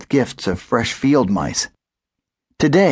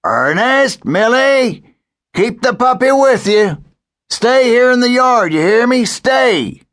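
loud, higher calling across the yard; 13 semitones higher, 4 dB louder than usual. A narrator's reading. Grandpa calls to the animals to stay put, loud. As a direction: call firmly across the yard. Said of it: "Grandpa called out".

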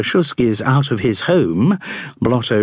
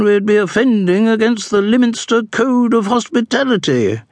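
high, haughty aristocratic matron; wealthy widow; 9 semitones higher than usual. A narrator's reading. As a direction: speak as a proud grande dame.

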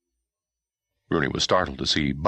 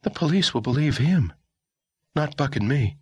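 put-on young woman voice; 11 semitones higher than usual. Girl character voice.

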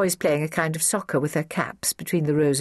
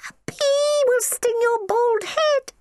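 put-on slow, squealed childish warning; piercing little child's shriek; high, shrill; slower than usual. A narrator's reading. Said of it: "in high, shrill voices".

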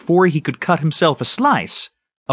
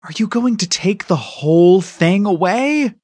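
put-on higher-pitched boyish whine; wailing; 4 semitones higher than usual. A narrator's reading. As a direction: plead like a child losing a treat. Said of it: "Danny wailed".

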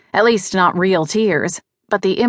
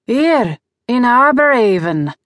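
put-on higher, faintly incredulous, Australian accent; how-could-you-not-know tone; 4 semitones higher than usual. A narrator's reading. Vance answers Paul's question on where it happened, mildly incredulous. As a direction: say it as if it's obvious. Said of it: "with a tone of, "How could you not know that?".

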